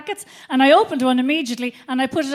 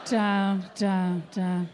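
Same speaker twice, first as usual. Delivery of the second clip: sinking low; 7 semitones lower, 6 dB quieter, much slower than usual. Acted. Comic acting out the crash, sinking low and soft. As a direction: lower and softer with each down. Coming down off the high.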